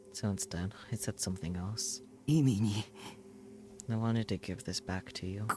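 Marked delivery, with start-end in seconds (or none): 2.3-5.5 very slow, halting and gentle; slow tender line. Acted. Hero to Rimi returning her handkerchief, slow and tender. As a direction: offer it gently, struggling for breath.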